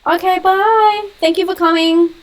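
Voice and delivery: high, loud, drawn-out goodbye; sing-song farewell. Real, not acted. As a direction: call out a lilting, high goodbye. Seeing off a leaving viewer.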